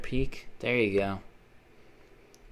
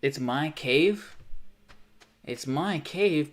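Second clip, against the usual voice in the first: slow confusion; 3 semitones higher, 3 dB louder, much slower than usual. Real, not acted. Confused denial, drawn out.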